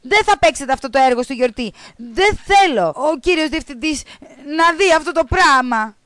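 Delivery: teacher yelling to end the rehearsal; teacher shouting. Acted. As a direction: stop the class in fury. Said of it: "η δασκάλα φώναξε".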